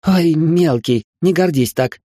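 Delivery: high, slow teasing scoff; snorting dismissal. Acted. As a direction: brush him off with a snort. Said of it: "фыркнула Ника".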